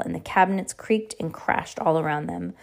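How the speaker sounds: ordinary delivery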